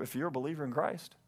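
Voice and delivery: ordinary talk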